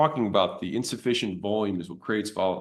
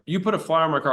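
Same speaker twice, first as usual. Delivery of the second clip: higher, accusing complaint; annoyed caller; 7 semitones higher, 3 dB louder, faster than usual. Retold or imitated. Angry caller to the gym owner, mock accusing. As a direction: play the irritated stranger on the phone.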